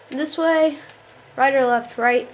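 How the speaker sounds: normal talking voice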